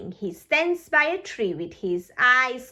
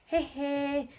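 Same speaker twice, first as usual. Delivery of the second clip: laugh; 3 semitones higher, 6 dB quieter than usual. Acted. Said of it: "laughs Peppa".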